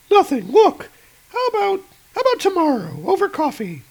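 high, bright agent voice; high female voice. Acted. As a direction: raise the pitch for the woman agent. Agent deflects.

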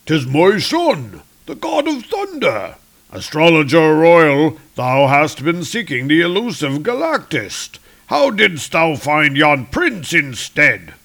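a king questioning his court astrologer; regal Odin. Acted. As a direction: proclaim like an aged king on his throne. Odin speaking.

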